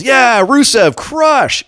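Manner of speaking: high excited ringside yell, imitating; imitating a hyped-up ringside shout; mock yell. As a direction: cheer it out high like a fan at ringside.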